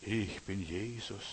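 slow, soft impostor voice; softly drawn-out false claim; deceiving devil. Retold or imitated. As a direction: imitate the devil posing as Jesus, drawn out and hushed. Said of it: "dem Teufel auf den Leim gehen".

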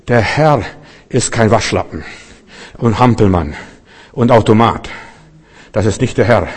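ordinary delivery

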